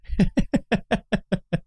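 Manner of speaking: soft laugh trailing off; breathy laugh. Real, not acted.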